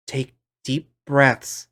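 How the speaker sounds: slowly calming; patient, slow reassurance; slow soothing. Acted. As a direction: guide the breath slowly. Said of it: "soothing voice".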